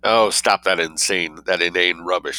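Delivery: usual speaking voice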